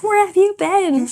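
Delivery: high, slow mock whine; lightly mocking viewer voice; imitating viewers. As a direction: play a needy fan asking. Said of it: "They're like".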